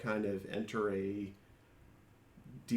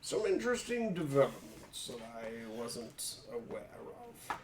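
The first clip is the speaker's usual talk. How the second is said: higher polite adventurer voice to the librarian; character voice; 5 semitones higher than usual. Acted.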